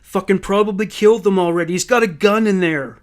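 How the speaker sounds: furious, urgent shout at police. A narrator's reading. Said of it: "Louis shouted at the police".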